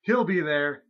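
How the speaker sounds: loud wrestling promo announcement; mock match hype. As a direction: announce the showdown like a big event.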